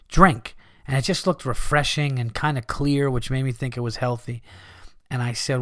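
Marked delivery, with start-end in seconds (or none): none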